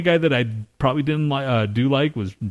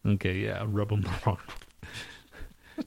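softer, laughing through the phrase "rub them the wrong way"; quiet laughing; 2 semitones lower, 11 dB quieter than usual. Real, not acted. Checking the idiom with his friend, then saying it softly through a laugh. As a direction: chuckle out "rub them the wrong way" after getting it right. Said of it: "Is that right?".

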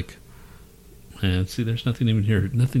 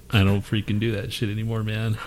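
ordinary talk

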